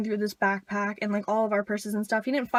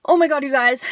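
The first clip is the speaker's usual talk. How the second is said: louder retold panic; freaking out; 3 semitones higher, 7 dB louder than usual. Trish to her friends as she spots trouble, mock panic. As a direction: imitate a friend's alarm, raised and urgent. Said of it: "Trish, like, freaks out".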